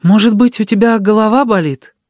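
high, anxious question; worried. A narrator's reading. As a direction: fret over an unwell child. Aunt worries about crying boy. (встревоженно, высоким голосом)

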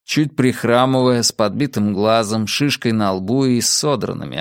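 ordinary delivery, nothing marked

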